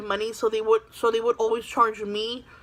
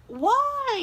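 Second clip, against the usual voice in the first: high, startled question; a teacher caught off guard; surprised why; 12 semitones higher than usual. Retold or imitated. Teacher reacts to no lunch money.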